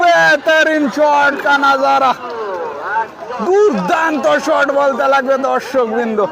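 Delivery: slow, high, shouted shot call; frantic football commentary. Real, not acted. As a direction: stretch it out like a stadium roar. Live football commentary, big shot.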